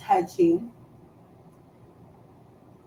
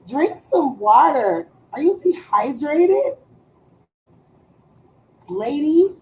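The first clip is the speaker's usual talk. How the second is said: high, rising mock-worried questions; teasing; 5 semitones higher, 4 dB louder than usual. Real, not acted. Roasting her staring daughter, playful mock concern. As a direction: needle her with fake concern.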